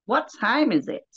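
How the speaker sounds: unsure imitation of an American accent; attempted American accent. Shown as a demonstration. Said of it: "I just can't get the accent".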